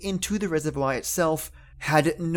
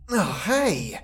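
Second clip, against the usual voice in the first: mild yell; 3 semitones higher than usual. A narrator's reading. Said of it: "yelled the young man".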